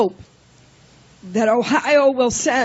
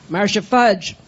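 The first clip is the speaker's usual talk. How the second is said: ordinary talk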